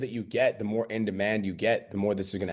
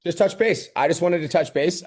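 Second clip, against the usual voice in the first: higher, louder, breezy mock check-in voice; mock client check-in; 8 semitones higher, 5 dB louder than usual. Coach mocking a polite check-in message to a client, sing-song. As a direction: play the overly chipper follow-up message.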